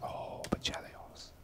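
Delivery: hushed excited whisper. Real, not acted. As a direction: breathe it in suspense. Tense moment on Chelios.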